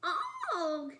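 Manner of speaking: high, slow, delighted gushing; happy monster sigh. Acted. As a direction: coo it like a proud monster parent. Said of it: "sighed Mister and Missus Monster happily".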